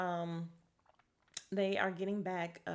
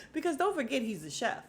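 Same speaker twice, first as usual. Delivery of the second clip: usual speaking voice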